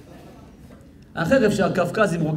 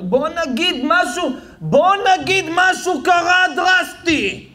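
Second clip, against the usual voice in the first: mock dramatic; 10 semitones higher, slower than usual. Mocking wife's exaggeration.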